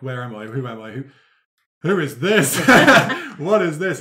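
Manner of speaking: a dazed man coming to, baffled; confused waking. Acted. Patched-up man waking in a strange cafe, bewildered.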